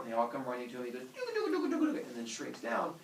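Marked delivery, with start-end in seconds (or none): 1.0-2.1 high, babbled cartoon shrinking sound; shrinking noise. Giant shrinks down to human size, high babble. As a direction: make a silly noise for shrinking.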